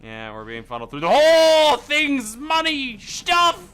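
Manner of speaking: high, loud hammed-up cheer; mock excited. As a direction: overplay the excitement.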